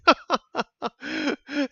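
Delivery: high burst of laughter in disbelief; incredulous laugh. Real, not acted. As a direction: laugh at the absurd call. Laughing at the decision.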